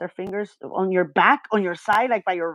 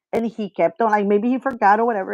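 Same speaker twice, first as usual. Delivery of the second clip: ordinary talk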